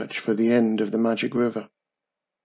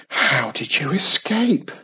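ordinary delivery, nothing marked

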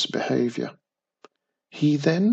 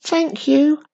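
tiny witch voice; 14 semitones higher, 6 dB louder than usual. A narrator's reading.

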